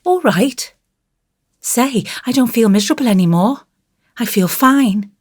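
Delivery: put-on high, cheerful child's voice; happy child, pitched high; relieved little boy. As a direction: chirp it like a contented small boy.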